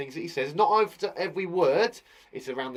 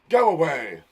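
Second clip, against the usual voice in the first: loud menacing shadow; hissing shadow; 9 dB louder than usual. Acted. Shadow ordering someone away, loud menacing voice. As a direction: send them away forcefully. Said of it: "the shadow hissed".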